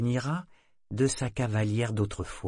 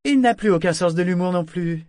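high, louder teasing aside; 9 semitones higher, 8 dB louder than usual. A narrator's reading. Figurehead confiding to Grag, loud cheeky aside. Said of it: "fit Ophélie à Grag en aparté".

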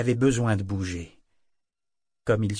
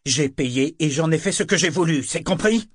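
loud, high yell ending in a demand; furious shout; 6 semitones higher, 7 dB louder than usual. Acted. Kenit shaking the artist by the throat, loud furious yell. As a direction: bellow it into his face. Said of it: "cria-t-il".

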